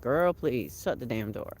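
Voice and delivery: lightly voiced chicken telling her off; sassy chicken. Chicken voiced grumbling at owner.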